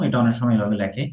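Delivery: normal talking voice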